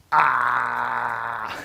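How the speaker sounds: a bright exclaimed Ah!. Shown as a demonstration. Teacher demonstrating the particle, exclaimed ah. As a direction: belt out the ah. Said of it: "examples of how we can use the".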